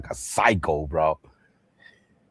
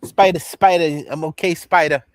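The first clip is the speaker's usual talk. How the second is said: higher, loud mock taunt; higher, louder hammed-up gangster taunt; 7 semitones higher, 7 dB louder than usual. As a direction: call to Spider, loud and pitched up. Quoting gangster mocking Spider.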